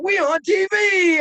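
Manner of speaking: high, loud mock TV shout; imitating Don's shout. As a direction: belt it out, mimicking the host's hype. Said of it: "you were like".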